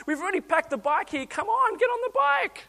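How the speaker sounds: high, pleading frustration; exasperated dad. Retold or imitated. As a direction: coax a stubborn kid onto the bike.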